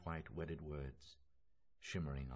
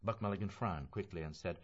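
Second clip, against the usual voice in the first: usual speaking voice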